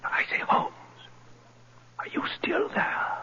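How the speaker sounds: nervous whisper in the dark. Acted. Banker whispering to Holmes in the pitch-dark cellar. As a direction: breathe it so no one hears.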